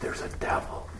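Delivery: hushed dramatic whisper; breathy revelation in a whisper. Real, not acted. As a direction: drop to a secret whisper.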